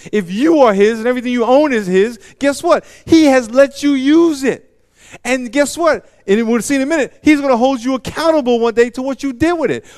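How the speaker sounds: preacher building to a loud high climax; full-force preaching. Acted. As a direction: drive the story home at full force.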